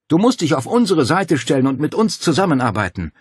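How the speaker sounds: loud, high, pressing appeal; urgent call. A narrator's reading. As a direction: call out to win her over. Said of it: "rief er".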